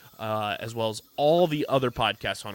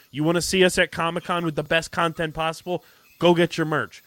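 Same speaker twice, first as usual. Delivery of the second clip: higher, louder hype for the merch; excited pitch; 5 semitones higher, 5 dB louder than usual. Acted. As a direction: sell it with a rush of hype. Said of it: "pumped ad pitch".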